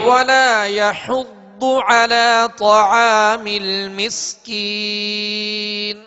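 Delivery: slow, drawn-out chanted Quran verse; slow tajweed recitation. Shown as a demonstration. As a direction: chant each word long and melodic. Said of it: "teacher recites verse".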